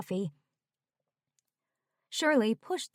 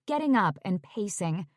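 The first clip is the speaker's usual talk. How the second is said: ordinary delivery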